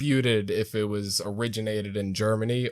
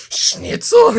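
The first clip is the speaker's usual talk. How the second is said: laughing high mock German schnitzel; mock German accent; 12 dB louder than usual. Imitating his friend's German accent while laughing. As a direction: squeal it through laughter like a German. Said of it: "He said, like".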